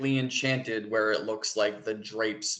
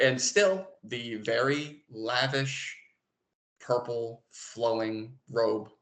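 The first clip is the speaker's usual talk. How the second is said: ordinary talk, nothing marked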